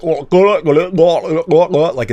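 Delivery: hammed-up British sounds made far back; throaty British accent. Shown as a demonstration. Showing listeners another British accent, throaty and garbled. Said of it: "other British accent that's very throaty".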